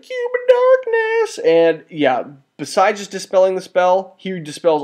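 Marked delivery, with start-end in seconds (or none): 0.0-1.4 very high, slow, hammed-up proclamation; over-the-top mock triumph; dramatic villain. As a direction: announce the artifact like a cartoon villain. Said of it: "He's like".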